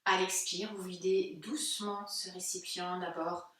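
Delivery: calm, unhurried guided-meditation voice with long pauses. Real, not acted. Yoga teacher guiding listeners' breathing into silence, calm meditative delivery. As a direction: guide listeners gently inward, leaving space between phrases.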